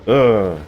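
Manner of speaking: loud, high frustrated ugh; sharp annoyed grunt; frustrated groan. Real, not acted. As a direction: let out an exasperated groan.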